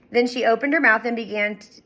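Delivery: plain reading voice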